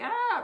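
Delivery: short, high panicked "ah"; hammed-up startled scream; mock scream. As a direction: let out a loud pretend shriek. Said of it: "I would've been like".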